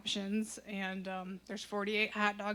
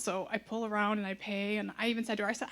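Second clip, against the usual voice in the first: ordinary talk, nothing marked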